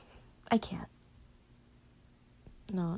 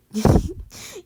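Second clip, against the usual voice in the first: laughing; 9 semitones higher, 11 dB louder than usual. Real, not acted. Laughs at own question.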